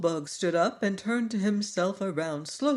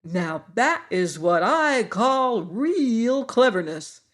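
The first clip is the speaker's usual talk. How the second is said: slow, warm praise with a higher lift; approving; 5 semitones higher, 5 dB louder, slower than usual. A narrator's reading. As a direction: nod along in approval. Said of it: "said the Scarecrow approvingly".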